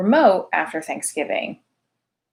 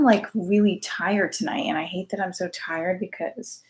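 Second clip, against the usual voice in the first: ordinary delivery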